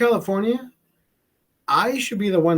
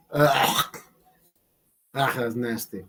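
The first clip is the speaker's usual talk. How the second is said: disgusted groan; 6 semitones lower, slower than usual. Real, not acted. Disgusted at own joke.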